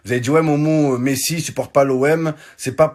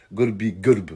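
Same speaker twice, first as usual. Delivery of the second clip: ordinary delivery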